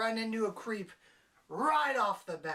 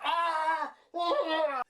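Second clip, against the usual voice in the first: shrill high yelps of disgust, much louder; mock scream; 10 semitones higher, 8 dB louder than usual. Viewer shrieking at filth flung in their face. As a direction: shriek as if crap hit your eyes. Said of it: "and then you were like".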